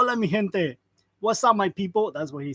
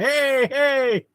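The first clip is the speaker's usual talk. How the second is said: higher, louder imitated objection; mock protest; 6 semitones higher, 5 dB louder than usual. Streamer imitating people objecting to his Mexico joke, mock protest. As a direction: call out like an offended listener, jokingly.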